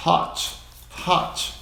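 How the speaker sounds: hot said short, no final vowel; English hot. Shown as a demonstration. Teacher models hot for Brazilian pilots. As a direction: say hot with a clean T ending. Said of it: "Não é hot".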